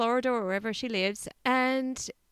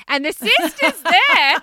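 high, loud, drawn-out exclamation; gleefully scandalised outburst; scandalised punchline; 8 semitones higher, 10 dB louder, slower than usual. Real, not acted. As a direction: land the shocking detail loudly, pitch up. Scandalised punchline, listener laughs.

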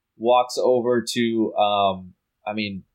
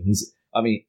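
ordinary talk

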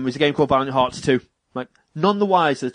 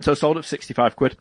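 ordinary talk, nothing marked

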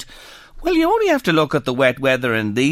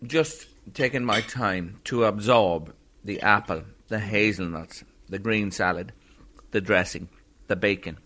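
quiet savouring; 8 semitones lower, 7 dB quieter than usual. Real, not acted. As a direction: savour each word softly. Host savouring the salad while tasting.

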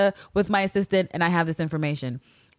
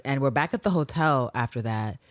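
ordinary talk

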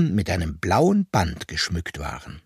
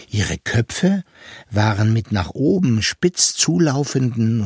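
ordinary delivery

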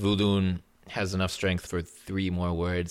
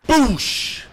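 loud imitated explosion; cannon boom; 11 dB louder than usual. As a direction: shout out the blast.

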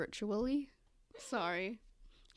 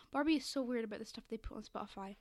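ordinary talk

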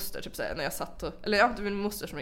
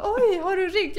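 high-pitched, louder innocent surprise; retold surprise; 12 semitones higher, 5 dB louder, slower than usual. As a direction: imitate a taken-aback grandmother. Voices grandmother's surprised reply. (härmad förvånad farmor, hög röst)

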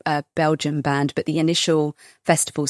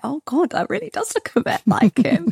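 retold surprise; 11 semitones higher than usual. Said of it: "it was like".

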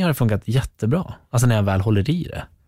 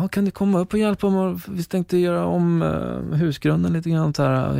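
higher, slower imitation of his easygoing dad; imitating dad; 9 semitones higher than usual. Son quoting his father's invitation, higher imitating voice. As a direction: put on dad's voice, much higher. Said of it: "han bara".

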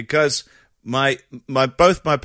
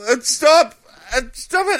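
put-on very high, loud flustered kid's voice; a boy trying to halt a caller's symptom list; panicky young boy; 5 dB louder than usual. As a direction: son cutting off a patient on the phone, panicky and high. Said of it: "I'd be like".

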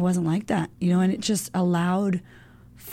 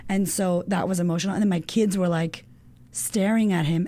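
ordinary talk, nothing marked

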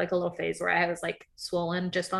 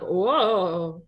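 put-on old man voice; 4 dB louder than usual. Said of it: "Was that your old man voice?".

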